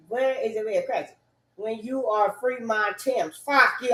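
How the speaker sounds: usual speaking voice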